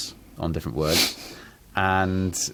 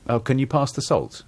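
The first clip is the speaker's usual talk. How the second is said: higher-pitched, offhand table request; everyday polite ask, pitched up; casual request; 7 semitones higher than usual. Shown as a demonstration. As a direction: ask lightly for the salt. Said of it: "sat at a table".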